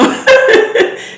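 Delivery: high, loud burst of laughter; tickled, high-pitched real laugh. Real, not acted. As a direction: burst out laughing mid-thought. Laughs at own accent slip.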